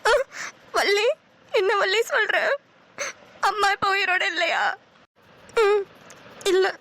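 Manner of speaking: breaking down at the news; grief-stricken. Acted. Learns mother is dead. (அழுது உடைந்த குரல்)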